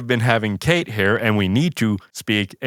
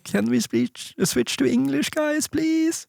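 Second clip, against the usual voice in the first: high sing-song mock Scandinavian accent, softer and pleading; fake Scandinavian accent; 13 semitones higher, 4 dB quieter, slower than usual. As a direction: imitate a friend's fake Scandinavian English. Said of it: "in this, like, fake Scandinavian accent".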